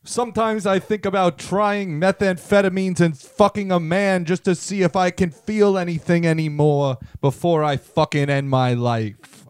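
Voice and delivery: ordinary delivery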